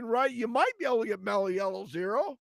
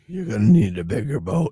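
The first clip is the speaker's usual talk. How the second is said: movie quote; 10 semitones lower, 9 dB louder than usual. Retold or imitated. Quoting Jaws line.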